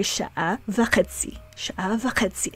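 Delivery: sha'a v'chetzi said softly, stress on chetzi. Shown as a demonstration. Teacher softly repeating sha'a v'chetzi for learners. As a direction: keep it soft and land on chetzi. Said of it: "So there's stress on the".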